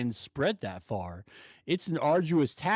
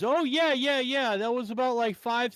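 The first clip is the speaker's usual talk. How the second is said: higher imitation of a coworker; recognizing coworker; 7 semitones higher than usual. Coworker confirming the Taco Bell story, high imitated voice. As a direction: mimic eager agreement, pitched up.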